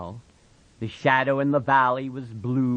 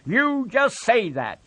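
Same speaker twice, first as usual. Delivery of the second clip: put-on higher, louder sharp old woman; sharp Aunt Clara; 11 semitones higher, 4 dB louder than usual. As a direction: snap it back at him. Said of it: "she said sharply".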